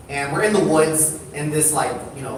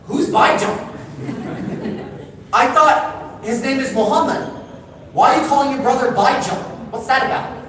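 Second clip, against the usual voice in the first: put-on higher, puzzled kid asking; a puzzled boy questioning, higher voice; confused kid; 6 semitones higher than usual. Palestinian kid asking the brothers, high and puzzled. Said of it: "He's like".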